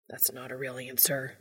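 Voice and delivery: soft, whispery protest; hushed insistence. A narrator's reading. Dean insists on an answer from Castiel, hushed. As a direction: insist in an undertone. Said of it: "he insists".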